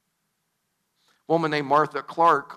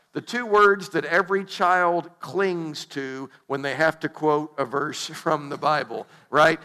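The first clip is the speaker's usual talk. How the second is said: normal talking voice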